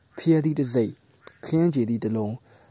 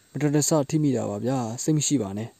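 plain reading voice

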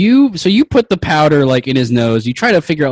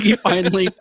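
ordinary talk, nothing marked

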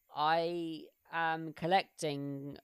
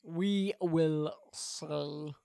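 slow drawl; much slower than usual. Pretend. Echo in a drawn-out voice.

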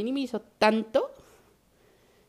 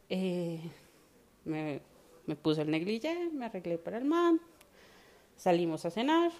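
ordinary talk

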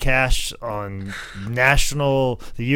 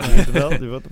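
louder jeering taunt at the loser; mock jeer; 8 dB louder than usual. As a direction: jab the words at him, louder. Mocking fans' reaction.

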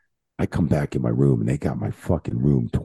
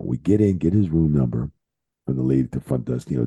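usual speaking voice

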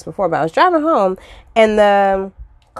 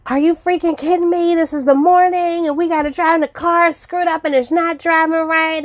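much higher, exasperated retold rant; wife losing it after the crash, pitched way up; flipping out; 10 semitones higher than usual. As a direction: vent in disbelief at the wrecked morning. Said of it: "so I flip out".

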